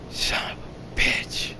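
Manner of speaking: slow, breathless whispered curse; hushed whisper. Retold or imitated. Hero learning the Queen is beneath him, whispered curse. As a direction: whisper it in shocked dread.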